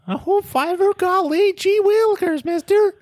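put-on very high golly-gee kid voice; old-timey kid. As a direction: squeal it like an awestruck farm boy. Hick kid voice gag.

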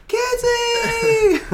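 high, loud squeal of a name; excited toddler shout. Retold or imitated. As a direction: squeal it like a thrilled two-year-old. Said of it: "she goes".

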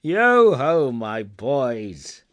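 big, loud hearty halloo; booming, jovial old employer; jovial boss. A narrator's reading. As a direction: holler merrily to the boys. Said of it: "said Fezziwig".

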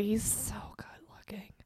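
breath-only whisper, very soft; whispered swoon. Real, not acted. Co-host swooning over the actor, whispered. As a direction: whisper it like a smitten aside.

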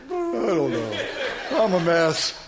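laughing through tears, a bit slow; embarrassed laugh at his own tears; teary laughing. Real, not acted. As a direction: laugh it off while still choked up. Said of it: "He cried a lot".

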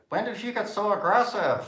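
high-pitched, mock-affronted reaction; taken aback. Colleague reacting to being told to stop interrupting, taken aback. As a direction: act out someone taken aback, voice jumping up. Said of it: "be a little bit taken aback".

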